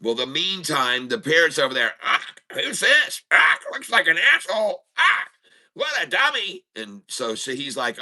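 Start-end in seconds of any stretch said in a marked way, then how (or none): 2.0-6.7 squawking parrot. Acted. The parrot insults the repairman in a squawky, much higher parrot voice. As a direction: play a rude parrot heckling a stranger.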